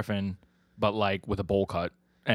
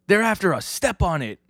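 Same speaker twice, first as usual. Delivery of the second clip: urgent command; 9 semitones higher than usual. Retold or imitated. Passenger urging driver to flee pursuers, urgent. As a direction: urge the driver on, pitched up.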